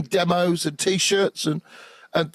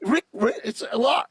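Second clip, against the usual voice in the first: overwhelmed excitement; 6 semitones higher than usual. Retold or imitated. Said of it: "I'm going".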